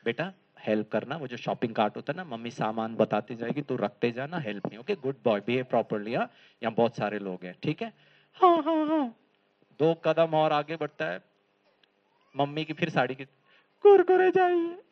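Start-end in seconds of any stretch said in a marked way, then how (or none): 0.1-7.9 lower, softer coaxing; coaxing mother. Retold or imitated. Mother quietly coaxes her son in the mall. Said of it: "mummy ने बोला". 8.3-9.2 high eager yeses; child agreeing. Retold or imitated. Little boy hastily agrees with mother. 13.8-14.8 put-on high, loud childish demand. Small boy tugs mother's sari for snacks.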